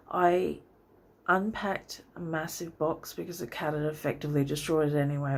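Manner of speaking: ordinary talk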